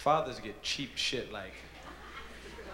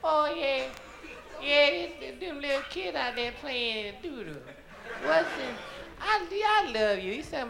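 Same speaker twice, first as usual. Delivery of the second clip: put-on high, wavering old-lady voice; senile grandma; 13 semitones higher than usual. Senile grandmother asks her grandson the time, high old voice. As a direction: ask like a confused old grandma. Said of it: "She say".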